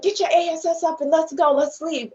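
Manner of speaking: high, imitated demand to get moving; retold angry order. Partner ordering her out of the theater, mock angry order. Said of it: "So he's like".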